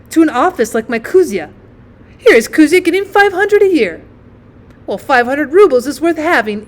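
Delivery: loud, scolding aunt, pitch leaping up; indignant aunt. Acted. Aunt blames the mother over the boy's schooling, indignant. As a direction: turn the blame on the mother with forceful jabs.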